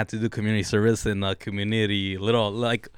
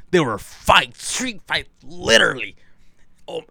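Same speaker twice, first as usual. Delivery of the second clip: boastful storyteller with big punched peaks and pauses; imitating an acquaintance. Telling friends how a guy bragged about wild exploits, imitated boastfully. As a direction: hype up each claim, stretching the key words. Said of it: "he was explaining it like that".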